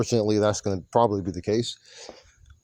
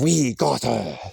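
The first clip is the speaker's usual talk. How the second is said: lightly put-on wrestler's voice; mimicked wrestler; slower than usual. Fan repeating Asuka's line to Rhea, lightly mimicked. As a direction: repeat her line in a mild imitation.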